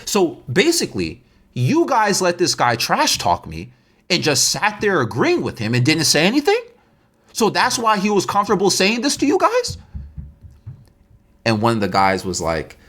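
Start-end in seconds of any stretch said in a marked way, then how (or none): 0.1-9.8 higher-pitched, incredulous confrontation; rising indignant question, acted; accusing. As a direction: mimic a betrayed friend calling them out. Friend confronts those who let insults pass.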